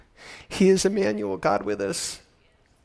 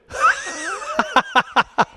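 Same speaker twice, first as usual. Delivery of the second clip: joyful shouting laugh. Real, not acted. Joyful outburst before preaching.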